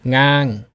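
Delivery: ngang held level; flat tone. Shown as a demonstration. Teacher showing each name carries its tone, ngang level. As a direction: say ngang without moving the pitch. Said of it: "flat tone".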